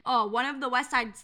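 usual speaking voice

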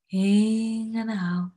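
slow breathing cue. Acted.

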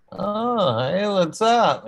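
high-pitched, uneasy mock hello; acted startled greeting. Man waking up to a cat on him, acted startled greeting.

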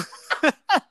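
high, disbelieving shriek of laughter. Real, not acted. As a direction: burst out laughing high and loud. Reacting to the racist quote.

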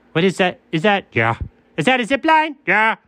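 normal talking voice

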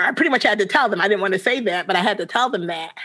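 ordinary talk, nothing marked

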